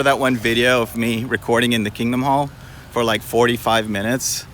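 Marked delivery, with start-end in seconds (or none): none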